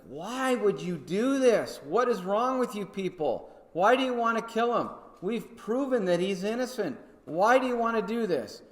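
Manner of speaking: high, pleading disbelief as Pilate; exasperated pleading. Acted. Preacher voicing Pilate begging the crowd to stop, high exasperation. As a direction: beg the crowd to see sense, voice way up.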